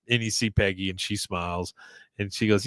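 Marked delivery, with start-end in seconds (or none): none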